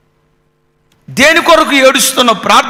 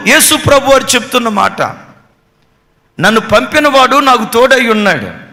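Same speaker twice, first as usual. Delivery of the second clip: normal talking voice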